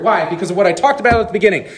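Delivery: ordinary talk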